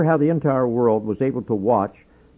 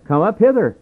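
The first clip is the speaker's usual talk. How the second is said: raised, higher call from heaven; heavenly voice; 6 semitones higher, 4 dB louder than usual. Retold or imitated. Preacher voicing the call from heaven, raised. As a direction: call them up, a bit louder. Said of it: "a great voice from heaven saying".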